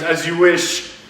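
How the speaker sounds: loud mock-mystical pronouncement; dramatic wish granter. Witch granting the wish as orb glows red, dramatic. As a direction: proclaim it with hammed-up ceremony.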